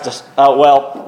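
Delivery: usual speaking voice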